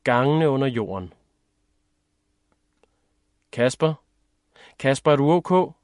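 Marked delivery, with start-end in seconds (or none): 3.5-5.7 put-on higher, louder girl checking on someone; concerned girl, pitched up; girl's voice. A narrator's reading. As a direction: call to a friend in a girl's raised voice. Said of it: "siger en velkendt pigestemme".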